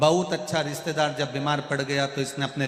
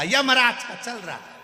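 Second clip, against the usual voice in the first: high exasperated outburst, falling at the end; angry retort; 8 semitones higher, 4 dB louder than usual. Acted. Sick man answering the deaf visitor, angry. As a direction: fire back in irritation, pitch raised. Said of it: "इसको आ गई गुस्सा".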